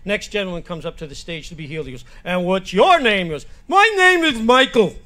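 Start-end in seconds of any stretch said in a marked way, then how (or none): 2.2-3.3 slow, loud stage question; faith-healer preacher. Acted. Preacher greeting next man on stage, slow and projected. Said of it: "he's preaching to the crowd". 3.7-5.0 high, loud, slow impeded speech; speech impediment. Acted. Man introducing himself to faith healer, loudly impeded speech. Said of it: "I have a speech impediment".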